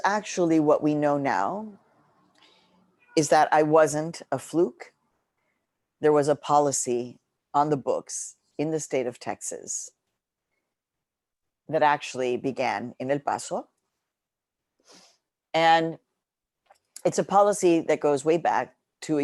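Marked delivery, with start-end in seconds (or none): none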